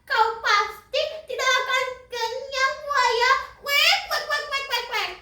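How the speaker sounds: high-pitched, stammering duck with frantic quacks; terrified duck. Acted. Duck begs crocodile not to eat him, high frightened pleading. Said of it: "Bebek pun menjerit ketakutan".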